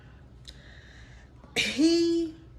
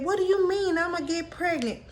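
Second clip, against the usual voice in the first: alarmed disbelief; 8 semitones higher than usual. Retold or imitated. Panicked teen questioning her boyfriend.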